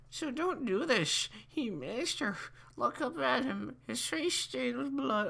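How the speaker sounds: squeaky, hammed-up old man reading. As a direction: put on a high old man voice. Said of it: "I'll do the old man voice".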